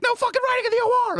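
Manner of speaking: very high, fast mock screech; imitating an outburst. Comic accusing another of stealing jokes, imitated high screech. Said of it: "She's like".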